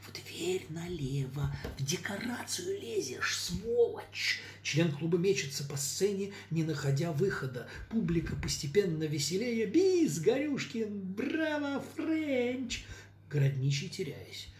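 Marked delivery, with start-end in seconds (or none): 0.1-4.4 urgent stage whisper. Acted. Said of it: "шёпотом". 9.7-12.8 high excited cheering; cheering crowd. Acted. Audience cheering.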